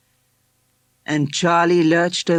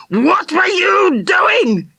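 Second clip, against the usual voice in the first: furious high yell, slow and out of control; bellowed; 5 dB louder, much slower than usual. Acted. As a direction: roar it at the boy in shock. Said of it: "he bellowed".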